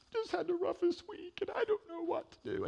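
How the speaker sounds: lightly voiced tearful lady; a hint of a crying woman; upset lady. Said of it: "she's a mess".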